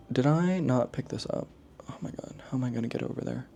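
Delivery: hushed self-talk, the book out of reach; whispered aside. Real, not acted. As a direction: whisper to yourself, caught off guard.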